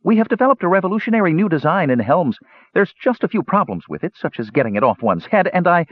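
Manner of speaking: high-pitched gnome inventor; high little gnome, earnestly explaining. A narrator's reading. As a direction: present it eagerly in a high voice. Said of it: "he said, talking more slowly".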